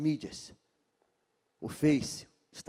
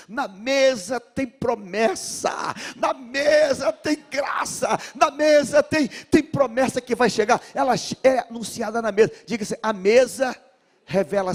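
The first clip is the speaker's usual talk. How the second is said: preaching at full force; 9 semitones higher, 8 dB louder than usual. Real, not acted. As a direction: shout it like a revival climax. (pregação em alta voz, cantada e forte)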